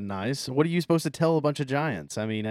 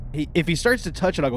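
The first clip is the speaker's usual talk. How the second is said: ordinary talk, nothing marked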